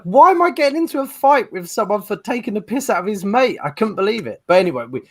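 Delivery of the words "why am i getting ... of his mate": high, incredulous protest; mock indignation. Man baffled by a pub fight, indignant. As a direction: protest at how absurd it is. Retelling his disbelief at the fight.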